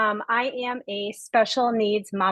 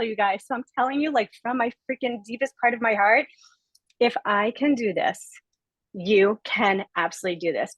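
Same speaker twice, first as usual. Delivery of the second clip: ordinary delivery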